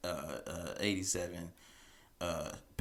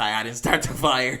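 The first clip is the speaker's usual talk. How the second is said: defensive denial; 3 semitones higher, 8 dB louder than usual. Retold or imitated. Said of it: "very demonstrative in their answer".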